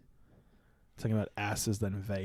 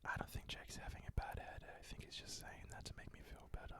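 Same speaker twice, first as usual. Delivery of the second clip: hushed whisper to the camera; 19 dB quieter, faster than usual. Real, not acted. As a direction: whisper it as a secret aside. Whispered aside to viewers.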